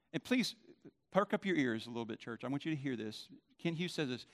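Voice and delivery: normal talking voice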